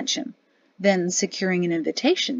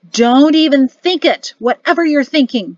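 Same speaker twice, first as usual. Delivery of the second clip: loud, higher-pitched warning; 5 semitones higher, 8 dB louder than usual. A narrator's reading. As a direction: cut off his scheme with a loud warning. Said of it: "Mari warned him".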